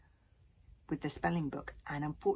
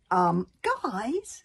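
higher, louder hesitant opening; sheepish; 5 semitones higher, 6 dB louder than usual. Acted. Tsar has to confess to the others, sheepish. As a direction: nervously get the group's attention. Tsar nervously admits a mistake.

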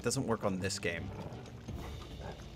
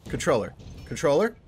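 loud, urgent repeated calls; raised voice, frantic repetition; urgent panic; 4 semitones higher, 6 dB louder, faster than usual. Real, not acted. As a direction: panic as the controller fails you, louder with each repeat. Controller trouble mid-fight.

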